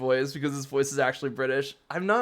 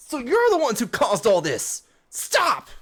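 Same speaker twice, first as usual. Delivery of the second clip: loud high yell of Stop; angry shout; 8 semitones higher, 5 dB louder than usual. Acted. Hop confronts the villains, angry shout. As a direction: cry out to halt them.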